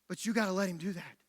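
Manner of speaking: sudden soft, low appeal; earnest hush. Real, not acted. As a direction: drop down to a quiet, sincere plea. Sudden hush after sermon climax.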